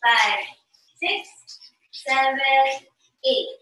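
dance count with long pauses between beats; slow, spaced-out teacher's count. Shown as a demonstration. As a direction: count the steps out very slowly. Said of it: "very slow".

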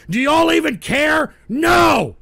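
indignant yelling at the audience; angry outburst. Real, not acted. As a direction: demand it, then yell the answer. Host berates uncaring listeners.

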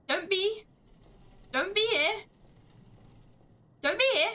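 mock threat. Player warning the unseen ghost, mock menacing. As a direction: warn it off with a high threatening push.

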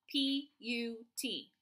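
slow, even letter spelling; spelled out slowly. Shown as a demonstration. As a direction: give each letter its own beat.

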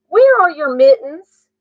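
mother cat checking on her kittens; startled mother. A narrator's reading. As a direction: ask it sharply, like a surprised mom.

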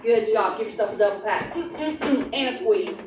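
plain reading voice

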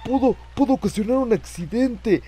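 high, agitated scolding; indignant rant. Real, not acted. Player scolding whoever caused the scare, indignant rant. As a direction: protest loudly, still shaken by the fright.

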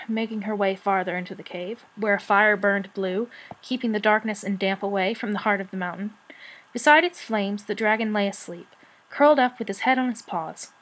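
ordinary delivery, nothing marked

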